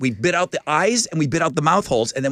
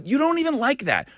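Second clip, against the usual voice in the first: incredulous; 10 semitones higher than usual. Retold or imitated. Parent doubting kid's promise.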